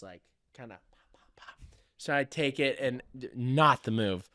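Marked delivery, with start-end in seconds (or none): 0.0-1.6 hushed whisper, barely voiced. Woodworker whispering to himself while easing out wood. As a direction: breathe the words as if popping it loose gently. Imitating popping the wood loose.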